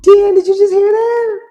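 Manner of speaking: very high, loud shriek of shock; real high-pitched alarmed yell; startled shout. Real, not acted. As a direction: cry out, startled by a sudden noise. Startled by a screeching truck outside.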